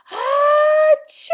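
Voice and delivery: loud, high sneeze blast; big sneeze. Acted. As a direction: let it explode. Another sneeze.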